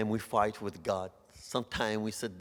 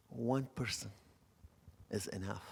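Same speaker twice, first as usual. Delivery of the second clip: soft voice of God; 11 dB quieter than usual. Retold or imitated. God reassures the preacher, soft and hushed. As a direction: let "One person" fall softly, then breathe "is enough". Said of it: "I hear God's voice".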